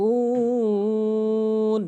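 উন held long on one steady note; three-alif stretch. Shown as a demonstration. Teacher shows a stop on madd, long held vowel. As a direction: chant উন, sustaining the "oo" into the n. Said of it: "তখন তিন আলিফ".

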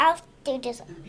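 whispering. Real, not acted. Whisper heard.

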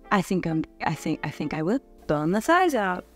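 normal talking voice

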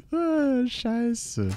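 high, soft curse after laughing; amused sigh. Real, not acted. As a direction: let it out high, still laughing. Zwischen zwei Lachern. (hoch und leise, noch lachend)

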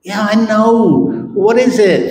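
high, loud, almost laughing with eagerness; impatient excitement. Retold or imitated. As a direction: blurt it out, can't wait. Man bursting with impatience.

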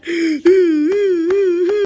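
a loud, high, crying gasp; loud fearful gasp; shocked gasp. Acted. Shocked by Clowny.

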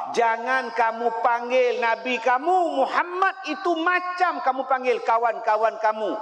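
usual speaking voice

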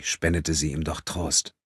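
plain reading voice

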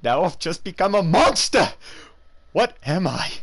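a scientist realising he has become a monster; horrified Doctor Jekyll. Acted. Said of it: "Doctor Jekyll and Mister Hyde".

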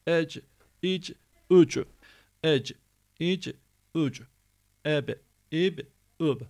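"Ec, ic, üc" and "Eb, ib, üb" punched with a bounce; echoed endings. Shown as a demonstration. Teacher repeating the drill, echoing "Ec, ic, üc, Eb, ib, üb". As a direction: make each final C and B rebound. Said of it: "Yani yankı yaptırıyoruz".

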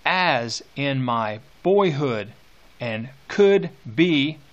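very slow, each phrase held apart with pauses; slow dramatic reading. Shown as a demonstration. Teacher rereading the line to the class, very slow and dramatic. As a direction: stretch O uncontrollable out as far as it goes. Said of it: "teacher rereads slowly for emphasis".

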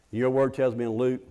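usual speaking voice

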